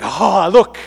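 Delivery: loud hammed-up cry of discovery; mock excitement. Mimicking hunter-gatherers spotting a find, in loud mock excitement.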